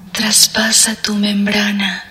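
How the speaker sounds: slow breathy whisper; right in your ear. Acted. Repeated line, whispered again.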